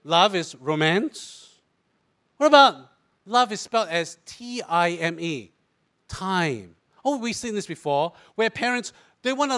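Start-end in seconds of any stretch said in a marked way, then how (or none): 0.0-6.8 slow musing. Acted. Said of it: "slow preacherly aside on love".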